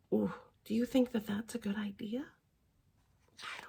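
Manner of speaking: hushed, anxious whisper; worried whisper. Acted. Reader whispering worry to the children. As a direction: ask it like a nervous secret.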